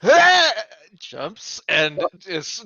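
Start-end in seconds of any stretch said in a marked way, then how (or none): 0.0-0.5 high shrill cry of alarm; falling yelp. Acted. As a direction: yelp as you fall. Roland jumps and misses edge.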